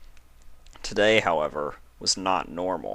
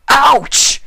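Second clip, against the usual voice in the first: sharp, very high, loud yelp; pained cry; 14 dB louder than usual. Acted. Spike's tail is cut by teeth, loud high yelp. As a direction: yelp out in sudden pain. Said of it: "he cried out".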